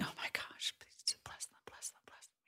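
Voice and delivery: barely voiced, hushed plea for blessing; whispered prayer. Chaplain silently praying over a grieving family, whispered. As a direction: breathe the prayer out under your breath. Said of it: "praying and thinking".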